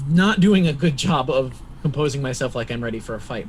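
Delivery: usual speaking voice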